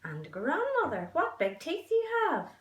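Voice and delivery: put-on a small girl alarmed at the teeth; little girl voice. Girl frightened by the wolf's teeth, high voice. Girl questioning the disguised wolf.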